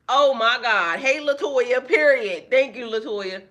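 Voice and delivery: loud, high, thrilled greeting; excited shout. Real, not acted. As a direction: shout with delight to a viewer.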